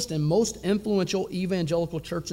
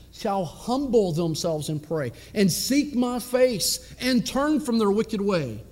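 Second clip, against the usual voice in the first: slow, raised, intoning the verse; preaching scripture; 4 semitones higher, slower than usual. Real, not acted. Preacher reciting Chronicles to his congregation, intoning. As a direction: proclaim it from the pulpit.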